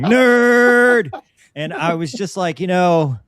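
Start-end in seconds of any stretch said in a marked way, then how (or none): 0.0-1.1 sudden high, loud yell. Retold or imitated. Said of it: "I was so close to yelling".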